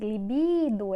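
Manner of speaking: liBIIdo, BI stretched and loud; stress on BI. Shown as a demonstration. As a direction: lean long on the middle of libido. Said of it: "é paroxítona".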